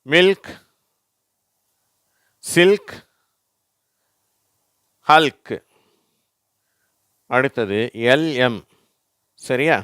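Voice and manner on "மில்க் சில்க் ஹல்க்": high, loud மில்க் with l and k both sounded; teacher's drill voice, மில்க் ending in a joined l-k; LK example words. Shown as a demonstration. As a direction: call out சில்க் and ஹல்க் singly for repeating. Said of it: "உதாரண வார்த்தைகள்".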